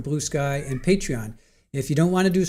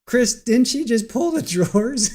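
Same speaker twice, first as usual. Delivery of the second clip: laughing; 8 semitones higher, 4 dB louder than usual. Real, not acted. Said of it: "I'm laughing so hard".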